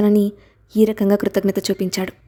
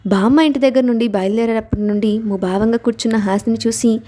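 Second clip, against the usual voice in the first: ordinary delivery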